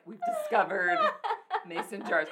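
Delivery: high, loud, hammed-up discovery; mock amazement. Imitating millennials who think they discovered something, mock amazed. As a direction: announce it like a ridiculous breakthrough.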